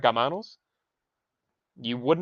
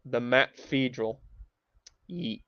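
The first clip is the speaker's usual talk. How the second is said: ordinary talk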